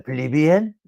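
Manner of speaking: "plebeian" ending on a rise, almost French. Shown as a demonstration. Echoing the French-sounding "plebeian", vowels emphasized. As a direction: lift the last syllable of "plebeian". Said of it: "It's almost French".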